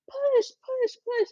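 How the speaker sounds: very high-pitched mock cheering-on; imitating urging nurses. Nurses behind the laboring mother, mock urgent. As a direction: chant it high, urging without real shouting.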